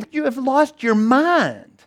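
high, slow scoffing reply; mocking disbelief. Disciples scoffing at the women, slow and high. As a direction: scoff at the crazy news.

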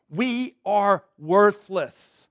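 slow, drawn-out admission of worthlessness; drawn-out humble confession. Acted. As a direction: let it sink, heavy and slow. Confession said before God.

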